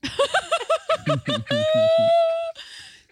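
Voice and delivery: high, loud burst of laughter; shrieking real laugh; big laugh. Real, not acted. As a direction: crack up hard at the punchline. Bursts out laughing at mom's line.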